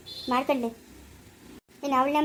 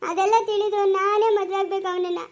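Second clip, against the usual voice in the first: weepy and desperate, slowed down; tearful plea; 6 semitones higher, 3 dB louder than usual. Acted. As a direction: plead through tears to marry him. Daughter insists in tears.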